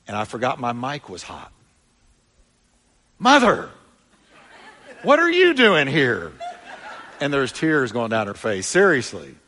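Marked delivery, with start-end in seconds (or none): 3.2-6.3 high, loud startled cry with a laugh; surprised shout. Retold or imitated. Preacher spotting his mother in church, loud surprised shout. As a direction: call out in laughing disbelief.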